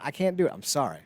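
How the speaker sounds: ordinary delivery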